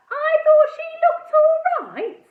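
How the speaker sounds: very high, loud mocking disbelief; incredulous echo. A narrator's reading. Girl mocking Edmund's words in disbelief. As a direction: echo it back, scandalised. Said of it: "I couldn't believe what I was hearing".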